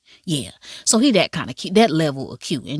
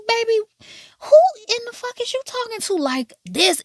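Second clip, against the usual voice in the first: mock indignant retort.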